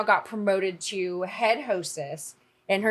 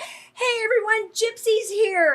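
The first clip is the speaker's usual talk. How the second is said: high, loud announcing voice; excited announcement; 13 semitones higher, 4 dB louder, slower than usual. Retold or imitated. Waitress announcing the crew's arrival, high and excited. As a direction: announce the arrival to the whole room. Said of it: "she's like".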